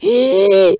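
sharp loud gasp of fright; startled gasp. Retold or imitated. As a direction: suck in air, startled. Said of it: "Me asusté".

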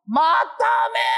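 very high desperate shout; screaming in pain. Acted. Woman in labor begging to die, very high scream. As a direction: scream it from the delivery bed.

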